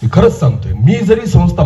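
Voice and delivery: usual speaking voice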